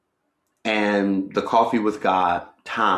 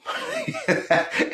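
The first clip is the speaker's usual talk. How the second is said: high laugh; 4 dB quieter than usual. Real, not acted. Laughs at own joke.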